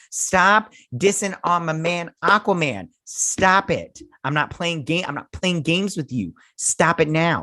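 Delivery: pretend-stern warning, punched words; mock scolding. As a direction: scold the director as an indignant fan. Mock scolding director to camera.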